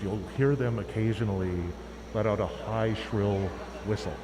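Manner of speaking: hushed, low wildlife narrator; nature documentary. Pretend. Continues mock wildlife narration.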